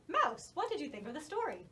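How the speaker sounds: plain reading voice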